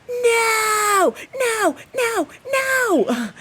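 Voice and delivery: high, wailing cries of no; panicked no. Retold or imitated. Retelling screaming no at a rat in the house. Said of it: "And I'm standing here saying".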